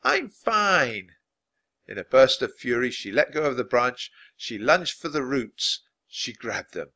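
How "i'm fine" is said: high, defiant cry; shouting back in protest; indignant shout. A narrator's reading. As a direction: insist on it, pitched way up. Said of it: "Annie shouted at the vulture".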